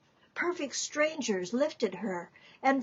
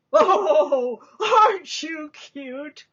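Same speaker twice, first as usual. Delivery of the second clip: a laughing "Oh", then a higher, slower coo; a big man charmed by a small girl; delighted big man; 5 semitones higher, 7 dB louder, slower than usual. Acted. Big bushy-browed man delights.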